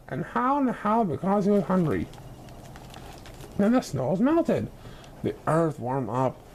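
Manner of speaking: plain reading voice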